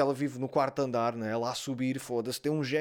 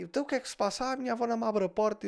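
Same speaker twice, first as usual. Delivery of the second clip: diálogo imitado; 7 semitones higher, much faster than usual. Retold or imitated. Diálogo vizinho e neta imitado.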